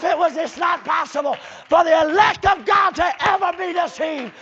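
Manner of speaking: loud, high, driving preacher's cry; fiery preaching. Acted. Preacher rousing his congregation, loud fiery preaching. Preacher shouting scripture and commentary.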